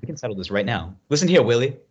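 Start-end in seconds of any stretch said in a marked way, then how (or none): 1.1-1.8 mock challenge. Movie-style challenge.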